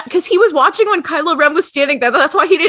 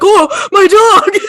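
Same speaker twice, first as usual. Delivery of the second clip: slow, loud, higher mock cry; mock wail; 6 semitones higher, 5 dB louder, slower than usual. Han mourning his dog, hammed-up slow wail. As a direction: ham up a grief wail over the dog.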